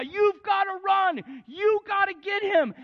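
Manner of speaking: high, loud, slow urgent yell; crowd screaming. Retold or imitated. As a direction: urge the fireman on from the stands. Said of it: "They start screaming at this guy".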